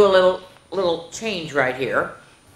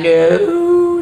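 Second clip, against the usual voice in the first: stubborn no; 12 semitones higher, 6 dB louder than usual. Acted. Stephanie's stubborn refusal.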